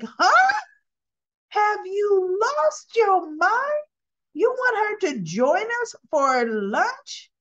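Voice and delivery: high, drawn-out mock outrage; Tracy, incredulous. Tracy aghast at Jack inviting Diane, hammed-up incredulity. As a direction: stretch each word in swooping disbelief.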